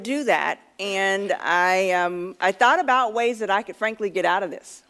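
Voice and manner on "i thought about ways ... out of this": ordinary talk, nothing marked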